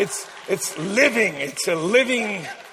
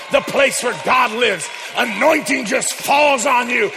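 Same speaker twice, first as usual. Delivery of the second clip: louder, driving sermon build; full-force preaching; 2 semitones higher, 4 dB louder than usual. Acted. Preacher loudly tells the church about anointing.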